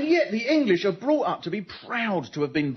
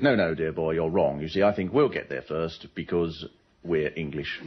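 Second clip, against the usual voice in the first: lightly voiced upper-class explorer; posh Englishman; 13 semitones lower than usual. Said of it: "Captain Scott said".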